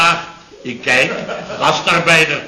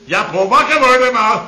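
wake-up call; 7 semitones higher than usual. Acted. Waking up drowsy Paul.

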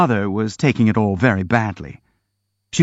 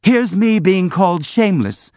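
cheerful contempt; 8 semitones higher than usual. A narrator's reading. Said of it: "with cheerful contempt".

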